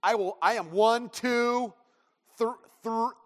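high, loud, stretched one, two, thr-; a dad stalling on three; dragged-out count. Retold or imitated. Dad counting down to his stubborn son, stretched and loud. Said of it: "And it's".

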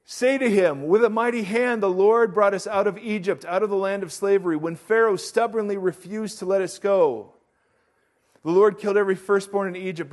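proclaiming the verse to the church; scripture reading. Acted. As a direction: read the passage with weight. Reading scripture aloud to congregation.

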